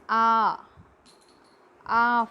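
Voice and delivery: a long open "ah" held out; vowel demonstration. Shown as a demonstration. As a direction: draw out "ah" to show the vowel. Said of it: "for its pronunciation".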